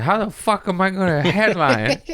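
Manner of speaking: high-pitched comic panic; exasperated dismay. Retold or imitated. Comedian in pain dreading having to headline, exasperated outburst. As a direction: replay a worked-up, despairing complaint.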